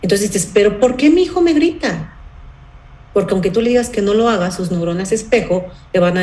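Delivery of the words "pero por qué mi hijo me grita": exasperated parent. Retold or imitated.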